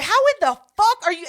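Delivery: loud, high, fast incredulous outburst; retold disbelief. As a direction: fire it out in mock disbelief. Retold outburst at friend's slip.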